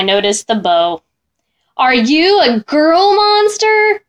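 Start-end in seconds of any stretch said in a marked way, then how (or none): 1.8-4.0 1 surprised. Acted. Boy asks surprised, voiced distinct.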